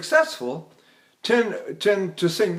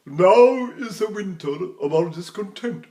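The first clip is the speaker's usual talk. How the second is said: slow, theatrical Shakespearean declamation; imitating a classical English actor; John Gielgud; 2 semitones higher than usual. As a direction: declaim like a grand stage actor. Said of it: "used to speak like this all the time".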